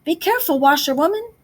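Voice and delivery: warning call. A narrator's reading. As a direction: call out to warn her. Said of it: "call out warningly".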